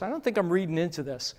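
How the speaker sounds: ordinary talk, nothing marked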